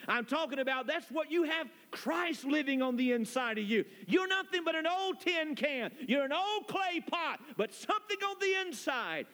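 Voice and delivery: high, ringing preacher's call on tin can; preacher lifting the congregation; preaching climax. Acted. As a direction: build to a fervent pulpit peak.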